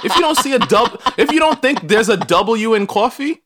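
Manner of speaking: high-pitched, worked-up mock outrage; mock indignation. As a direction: scold them in a high, playful huff. Mock indignant defence of accent.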